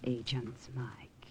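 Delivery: breathy hushed whisper. Acted. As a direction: whisper his name so nobody overhears. Hushed warning about spies.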